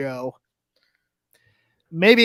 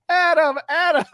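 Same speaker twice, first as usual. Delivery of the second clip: high mock yell of a name; hammed-up cry for a friend; imitating a scream; 11 semitones higher, 4 dB louder than usual. Co-host retelling the hospital bed scene, mock screaming. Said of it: "Roddy's screaming".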